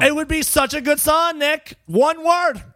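high, loud, worked-up insistence; exasperated outburst. Real, not acted. Co-host telling Nick the song could be fixed, exasperated outburst. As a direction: burst out at full pitch to drive the point home.

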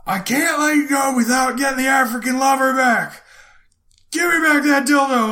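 high put-on shout; mock yell. Clerk yelling after the walking thief, high mock shout. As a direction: chase him down yelling, hammed up. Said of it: "So I'm like".

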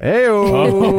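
high, loud, drawn-out heckle; rowdy whoop. Real, not acted. Co-host cheering a director's uncut joke, rowdy whoop. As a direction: whoop at the dirty punchline.

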